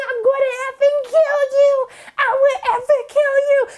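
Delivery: high imitated threat; mock fury. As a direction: do June's threat pitched high. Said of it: "She was like".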